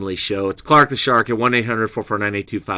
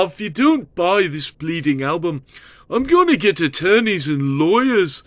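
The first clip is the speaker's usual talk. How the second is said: mock British accent; 6 semitones higher than usual. Radio host threatens to sue listeners, hammed-up British accent. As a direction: rant like a cheeky Liverpool rock star. Rant in put-on British rocker voice.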